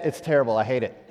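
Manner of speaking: ordinary talk, nothing marked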